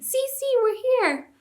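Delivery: very high squeaky little-animal voice; squealing with joy. A narrator's reading. Mice greeting the king, high joyful squeal. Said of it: "squealing with joy".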